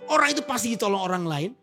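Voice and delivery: full-force preaching. Acted. Pastor exhorting the congregation to be generous, preaching at full force. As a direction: preach it out to the hall with fervour.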